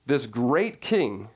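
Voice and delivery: usual speaking voice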